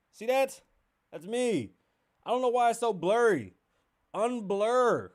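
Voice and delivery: yelling at the blurry picture; excited outburst. Real, not acted. Host shows phone to viewers, loud excited exclaims.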